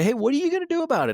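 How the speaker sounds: high, fast, pushing the question out; bold challenge. Shown as a demonstration. Urging associates, fast high challenge. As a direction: say it without fear, quick and high. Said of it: "Don't be scared to say".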